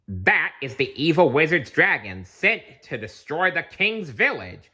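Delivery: a touch of urgent alarm, slowing at the end; exclaiming. Acted. Squidly warning about the dragon, mild urgent exclaim. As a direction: warn dramatically. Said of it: "exclaimed Squidly".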